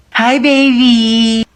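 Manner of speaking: very slow, drawn-out greeting; tender baby talk. Acted. Sitter greeting the toddler, very slow tender coo.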